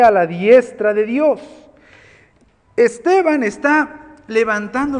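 loud, emphatic preaching; full-force preaching. Acted. Preacher drives home his second point, preaching loudly. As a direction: proclaim it to the whole church.

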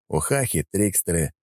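ordinary delivery, nothing marked

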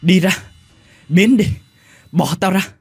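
loud, high panicked cries; delirious cry. A narrator's reading. Feverish man yelling at a ghost in a nightmare. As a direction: push the ghost away in terror. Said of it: "Mạnh mơ màng".

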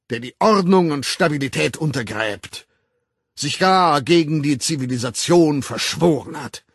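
loud, high, formal public denunciation; a director condemning an employee before the staff; stern director's speech. Acted. Director denouncing Bernard to the assembled staff, loud and stern.

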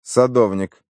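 terse one-word answer, little inflection; laconic. A narrator's reading. Slack fobbing off the eager nephew, laconic. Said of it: "лаконично ответил Слак".

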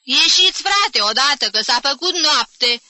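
loud, high impatient shout; exasperated calling out; yelling at boarders. A narrator's reading. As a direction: holler them out of their rooms. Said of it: "țipând la pensionare".

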